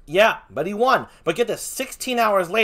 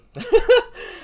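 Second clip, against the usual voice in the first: laugh; 4 dB louder than usual. Real, not acted. Laugh mid story.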